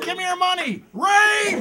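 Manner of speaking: high, loud yelling of Give me your money; panicked screaming in the mugging; shouting mugger and victim. Acted. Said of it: "He's like".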